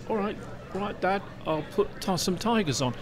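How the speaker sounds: lightly acted dutiful son, quiet and high; obliging Noah. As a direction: give a mild, meek yes to Dad. Noah answers God in retold story.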